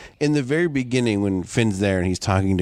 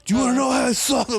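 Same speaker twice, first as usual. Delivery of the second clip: much higher, eager question; excited quote; 12 semitones higher than usual. Retold or imitated. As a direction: blurt it out keenly. Quoting the movie character.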